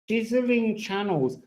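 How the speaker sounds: ordinary delivery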